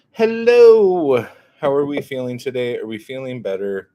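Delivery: high, loud cartoonish hello; loud, fast sing-song greeting. Exaggerated greeting, then normal talk.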